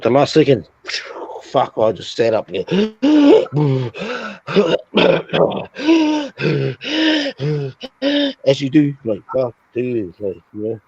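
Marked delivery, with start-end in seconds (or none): 2.7-8.4 long, high, ragged gasp; a big startled gasp awake; desperate gasp for air. Retold or imitated. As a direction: gasp like surfacing from drowning. Waking up gasping.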